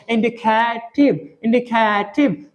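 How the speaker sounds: इंडिकेटिव drawn out slowly with an aspirated t; slow demo indicative. Shown as a demonstration. Teacher slowly models इंडिकेटिव for the class. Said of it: "इंडिकेटिव नहीं बोलना ऐसे".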